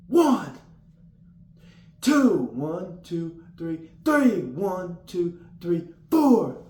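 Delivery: loud, high shouted numbers; yelling the count. Retold or imitated. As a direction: holler every number like you're in the dorm. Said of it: "just yelling".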